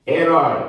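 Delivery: "Herói" with a loud, high, open ói; open diphthong. Shown as a demonstration. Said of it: "som aberto". ("Herói" com som aberto no ói)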